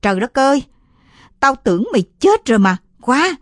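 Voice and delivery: high, loud, fast shrieking aunt; shrill shriek. Acted. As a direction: screech at the boy in alarm. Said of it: "Giọng dì rít lên the thé".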